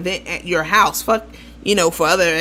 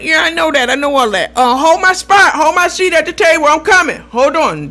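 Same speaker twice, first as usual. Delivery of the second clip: raised, higher voice, acting out a caller; distracted caller; 7 semitones higher, 5 dB louder than usual. Caller breaking off to save her seat at the table, distracted. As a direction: call out over someone, cutting the conversation short. Acted phone call, casino distraction.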